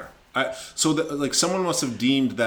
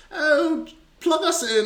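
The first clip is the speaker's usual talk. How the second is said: much higher put-on little voice; talking gadget; slower than usual. Imagined box asking to be plugged in, high pretend voice.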